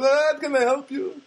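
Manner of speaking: high, drawn-out mock foreign-girl voice; put-on high-pitched foreign girl; foreign shopgirl. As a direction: imitate a foreign counter girl, loud and slow. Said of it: "She's kind of a foreign kind of girl".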